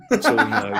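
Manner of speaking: a high laugh breaking into the words. Real, not acted. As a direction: giggle through it. Laughing at Rice nationality joke.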